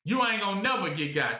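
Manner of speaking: ordinary talk, nothing marked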